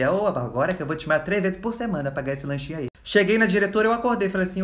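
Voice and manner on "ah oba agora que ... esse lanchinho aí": soft, lowered aside; secret scheming. Acted. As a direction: plot it under your breath. Secret thought while faking a faint.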